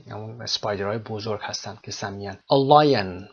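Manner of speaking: ordinary talk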